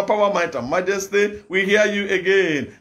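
usual speaking voice

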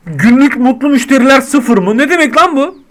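high, loud outraged reading of the stats; can't believe the number; indignant surprise. Real, not acted. Shop owner reading his daily stats, loud outrage. Shocked at zero happy customers.

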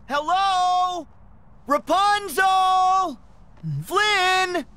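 high, drawn-out searching call. Acted. Searcher calling for the missing Rapunzel and Flynn, high drawn-out call. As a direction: call into the distance, holding each name.

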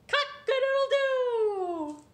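high, loud, slow rooster crow; drawn-out cock-a-doodle-doo. Acted. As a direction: crow long and proud. Rooster Gloria crows.

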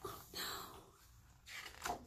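tiny frightened whisper; a little mouse in fright; whispered gasp. Acted. Mouse girl gasping at the birthday, frightened whisper.

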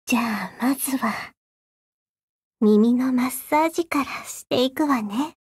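close, hushed devil whispering; ASMR whisper. Acted. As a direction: whisper softly into the ear.

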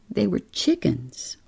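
plain reading voice

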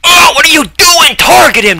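high, loud shout at a useless ally; screaming at the game; real frustrated shout. Real, not acted. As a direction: yell in disbelief at a teammate. Teammate fails to target.

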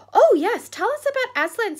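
put-on children thrilled by a name; excited chorus. A narrator's reading. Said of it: "said several voices at once".

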